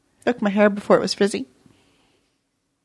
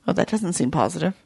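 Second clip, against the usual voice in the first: ordinary delivery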